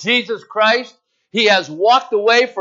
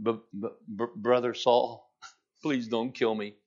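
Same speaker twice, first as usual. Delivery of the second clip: softer, stuttering plea, voice dropped low; frightened stutter, hushed and lower; stammering in fear; 7 semitones lower, 11 dB quieter than usual. Retold or imitated. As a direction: beg for your life, tripping over the first word. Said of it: "I can imagine he went to the house and says".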